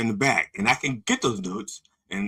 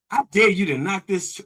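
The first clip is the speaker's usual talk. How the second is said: put-on taunting kid; 7 semitones higher, 6 dB louder than usual. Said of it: "he gets in the middle and goes".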